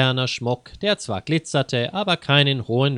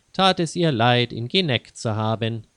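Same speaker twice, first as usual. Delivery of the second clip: ordinary delivery, nothing marked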